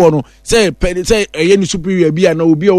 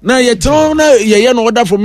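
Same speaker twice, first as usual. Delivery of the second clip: ordinary delivery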